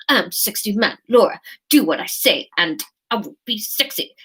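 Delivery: mock-seductive command through pursed lips; hammed-up sexy man flirting. Shown as a demonstration. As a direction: play a parody seducer. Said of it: "That's my voice".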